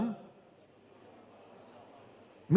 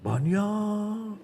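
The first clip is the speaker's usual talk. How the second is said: high, drawn-out mock dismay; bisikan syaitan; 5 semitones higher than usual. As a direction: stretch the word out in a raised voice. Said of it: "langsung dibisikin". (setan mengeluh panjang bernada tinggi)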